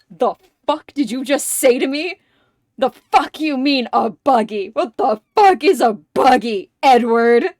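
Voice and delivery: loud, acted-out indignant outburst; loud, incredulous mock fury; outraged. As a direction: blow up at Edward in mock outrage. Acts Oswald's outraged reaction.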